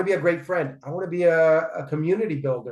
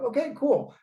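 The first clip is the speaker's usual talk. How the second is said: much higher, a bright little okay cool; pleased surprise; 11 semitones higher than usual. Retold or imitated. Man retelling his delight at learning a fact, pleased surprise. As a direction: act tickled by a new fact. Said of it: "I go".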